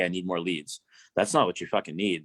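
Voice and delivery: ordinary delivery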